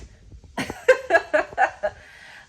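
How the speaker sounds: breathy incredulous laugh; disbelieving laugh. Real, not acted. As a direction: laugh at the absurdity.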